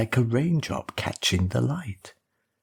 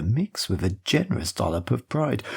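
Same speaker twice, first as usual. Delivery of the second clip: plain reading voice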